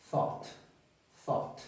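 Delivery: THOUGHT said softly as one separate syllable; model pronunciation. Shown as a demonstration. Teacher shows how thought is pronounced, soft model pronunciation. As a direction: quietly model THOUGHT, leaving space after it. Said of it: "Y se pronuncia, eh, más o menos así".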